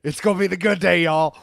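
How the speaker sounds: rallying cheer to the audience; hyped up. Real, not acted. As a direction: pump up the crowd, big and loud. Hyped repeat.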